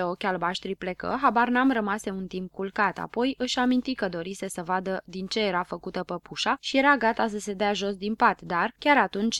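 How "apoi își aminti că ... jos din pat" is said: ordinary delivery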